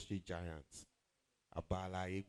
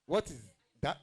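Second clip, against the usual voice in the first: put-on voice; 6 semitones higher, 9 dB louder than usual. Real, not acted. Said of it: "That voice is doing something to you".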